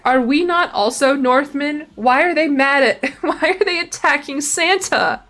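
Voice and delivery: high, drawn-out incredulous questions; amused indignation. Real, not acted. Streamer objecting to Santa being attacked, amused indignation. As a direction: protest the injustice, breaking into a laugh.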